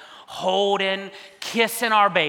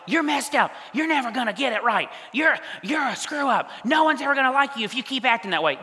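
high-pitched imagined maternal scolding; harsh scolding; 5 semitones higher than usual. Pretend. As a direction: berate him as a mock angry mother.

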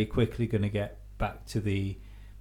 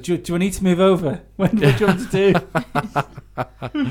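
flustered driver; 6 semitones higher, 7 dB louder than usual. Retold or imitated. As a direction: play a baffled driver asking what to do. Acting out driver unsure in car.

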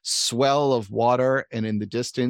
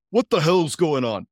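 louder, gruffer retake of the boss line; 2 semitones higher, 4 dB louder than usual. Acted. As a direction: bark it like an annoyed boss. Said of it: "Uh, right".

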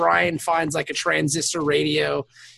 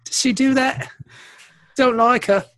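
Julian voice; 5 semitones higher than usual. Retold or imitated. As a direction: sneer it out as a bratty Julian. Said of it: "I like your new Julian voice".

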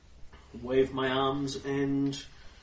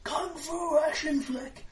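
hammed-up action hero; slower than usual. Character battle cry.

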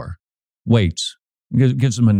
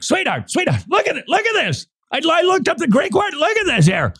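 high, eager pleading to his wife; much higher, breathless eagerness showing wife a find; excited to spouse. Retold or imitated. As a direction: gush with discovery, very high. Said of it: "I'm like".

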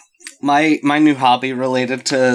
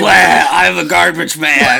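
loud, slow, hammed-up slob impersonation; mock drunken shout, dragged out; Johnny Depp; 4 semitones higher, 5 dB louder, slower than usual. Shown as a demonstration. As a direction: bellow it as a grotesque caricature. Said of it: "I need you to do a Johnny Depp impersonation".

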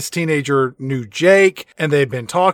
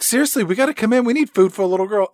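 fast pleading; 5 semitones higher, much faster than usual. Retold or imitated.

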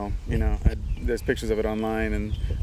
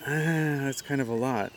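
reluctant second voice; 3 semitones higher than usual. Retold or imitated. Inner second voice balking at giving, mock reluctance. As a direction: balk gently at the cost, acted. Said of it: "second voice that comes in".

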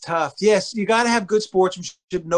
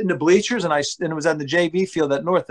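usual speaking voice

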